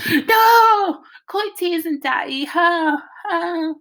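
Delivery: very high, mushy wail; wailing stuck-lipped son. A narrator's reading. Byron to teasing Daddy with tongue-tied lips, high wail. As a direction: howl it high, then sob it out.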